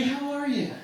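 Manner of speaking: usual speaking voice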